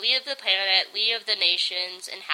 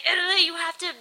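higher, pleading urgently as Germany; urgent plea; 7 semitones higher than usual. Retold or imitated. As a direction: shake him and beg him to laugh. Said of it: "and he's like".

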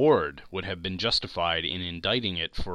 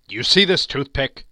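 Scrooge challenging; 5 semitones higher, 9 dB louder than usual. Acted. Scrooge challenges the staring ghost.

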